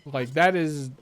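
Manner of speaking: normal talking voice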